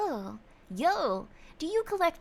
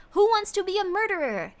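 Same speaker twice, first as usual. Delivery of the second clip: raised pitch, stretched out with relish; hyped title announcement; 8 semitones higher, 6 dB louder than usual. Pretend. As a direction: announce it like an excited game-show host. Announces next game title, hyped.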